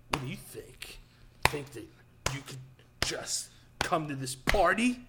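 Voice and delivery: hushed, halting mock menace, sinking into a whisper; movie tough-guy talk. Movie bully confronting the party crasher, hushed and halting menace. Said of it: "People always push talk in movies".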